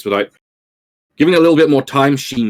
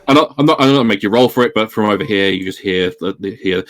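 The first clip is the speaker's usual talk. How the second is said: normal talking voice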